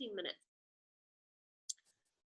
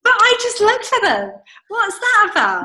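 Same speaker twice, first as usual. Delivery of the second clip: high loud disbelief; baffled the emails reappeared; high loud incredulous cry; incredulous surprise; 11 semitones higher, 21 dB louder than usual. Retold or imitated. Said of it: "And I'm like".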